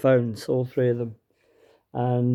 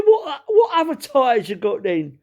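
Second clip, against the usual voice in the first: high, slower Cockney haggler; 10 semitones higher, 3 dB louder than usual. Retold or imitated. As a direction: press the salesman in broad East End. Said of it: "this guy was proper Cockney".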